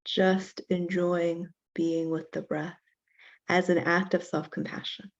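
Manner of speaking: slow, measured meditation voice with long pauses; guided meditation. Real, not acted. Teacher leading a class in self-compassion breathing, guided meditation. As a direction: count the breath out evenly, unhurried, guiding them into stillness.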